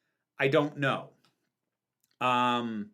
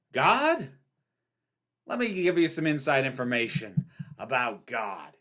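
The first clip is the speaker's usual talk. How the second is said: Al Pacino impression. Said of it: "when he goes off".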